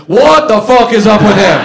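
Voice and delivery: loud shocked outburst; kid blurting out. Retold or imitated. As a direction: blurt it out loud, no longer playing it cool. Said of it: "kid to be out in public going".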